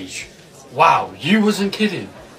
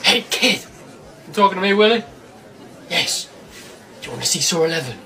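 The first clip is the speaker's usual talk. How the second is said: usual speaking voice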